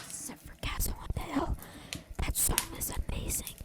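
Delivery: hushed, secret confession; whispered aside. Real, not acted. Aside about a good song.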